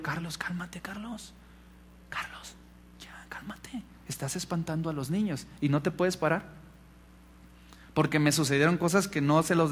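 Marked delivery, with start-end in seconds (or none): 0.0-3.8 hushed, urgent whisper; whispered in the ear. Retold or imitated. Wife to crying husband at his ear, whispered plea. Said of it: "me decía al oído". 4.1-6.4 softly spoken, half out of a whisper; retold hushed warning. Wife warning husband about frightened children, retold softly. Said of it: "me decía al oído".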